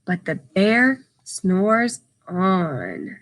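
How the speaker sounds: very slow, drawn-out storybook line; slow sleepy refrain. Acted. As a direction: stretch it out like the bear is snoozing. Sleepy refrain read to children.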